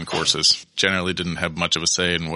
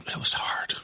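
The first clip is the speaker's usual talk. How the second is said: whispered; 5 dB quieter than usual. Real, not acted. Recalling a grueling kitchen job.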